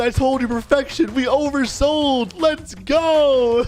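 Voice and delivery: high-pitched triumphant cheer; excited celebration. Acted. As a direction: crow over a sold-out show. Director celebrating sold-out premiere.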